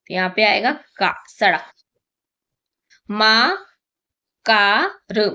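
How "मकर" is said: मकर drawn out very slowly, testing; wrong word tried slowly. Shown as a demonstration. Teacher testing a wrong word for the children, slowly. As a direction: sound out मकर slowly as a guess. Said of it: "मकर नहीं".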